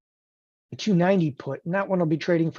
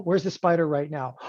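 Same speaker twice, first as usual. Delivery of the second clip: ordinary talk, nothing marked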